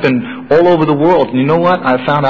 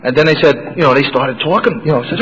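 ordinary delivery

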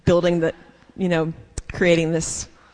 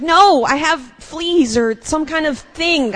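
mock protest; 9 semitones higher, 4 dB louder, slower than usual. Insisting to dermatologist.

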